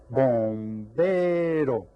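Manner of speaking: very slow, sustained syllables; syllables drawn out. Shown as a demonstration. Teacher presents a word to the class, syllables drawn out. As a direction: stretch each syllable of "bombero". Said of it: "How about this word?".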